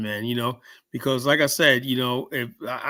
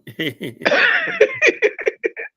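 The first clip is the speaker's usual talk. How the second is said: high burst of laughter; 4 dB louder than usual. Real, not acted. Laughing at own story.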